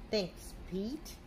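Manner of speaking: usual speaking voice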